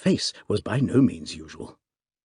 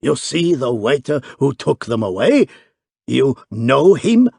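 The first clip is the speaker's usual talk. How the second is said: excited panting; 4 semitones higher, 6 dB louder than usual. A narrator's reading. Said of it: "panted the excited hotel keeper".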